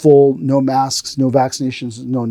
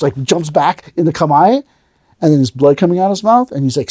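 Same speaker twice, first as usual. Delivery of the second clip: normal talking voice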